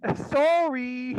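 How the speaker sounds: sheepish hammed-up Canadian accent; freed Canadian teen apologizing, in a high mock Canadian accent; Canadian kid. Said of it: "You go".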